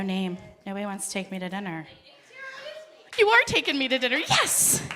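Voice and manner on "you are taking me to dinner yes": fast, high burst into a squealed yes; delighted. Real, not acted. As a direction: celebrate the offer, leaping up in pitch. Delighted someone offers her dinner.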